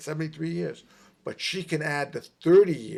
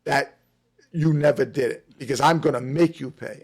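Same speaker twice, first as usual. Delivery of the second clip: stern threat; 9 dB louder than usual. Retold or imitated. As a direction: lay down the law, eye to eye. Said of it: "I looked him right in the eye. I said".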